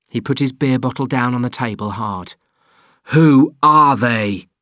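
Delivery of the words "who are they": loud, slow, hammered question; shouted. A narrator's reading. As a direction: shout the demand at him. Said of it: "he shouted".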